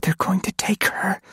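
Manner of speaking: tight, quivering whisper; frightened whisper. Acted. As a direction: whisper in rising terror. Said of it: "her voice a tight, quivering cord".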